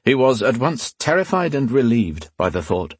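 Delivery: plain reading voice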